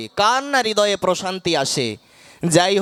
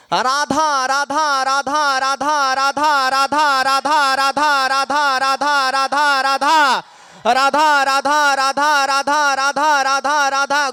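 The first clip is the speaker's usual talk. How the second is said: higher, drawn-out weeping calls of Radha; tearful calling out; 4 semitones higher, much slower than usual. Retold or imitated. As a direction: voice Govinda crying out for Radha, stretched and pleading. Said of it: "উনি কানতে কানতে রাধা নাম জপ করছেন". (কাঁদতে কাঁদতে টেনে টেনে রাধা নাম ডাকা)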